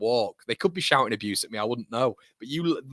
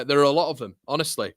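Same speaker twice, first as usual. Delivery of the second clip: ordinary talk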